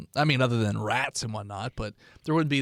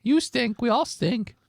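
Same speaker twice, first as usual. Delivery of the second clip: homeless man voice; 10 semitones higher, slower than usual. Acted. Homeless NPC answers.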